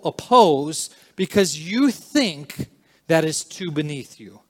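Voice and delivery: forceful sermon build; emphatic preaching. Real, not acted. Raised-voice preaching.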